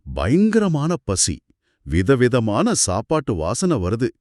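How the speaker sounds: usual speaking voice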